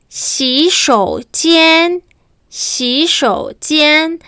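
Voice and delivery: "Xishoujian" stretched out very slowly, each syllable apart; slow word demo. Shown as a demonstration. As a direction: spell out "Xishoujian" with your voice, slowly.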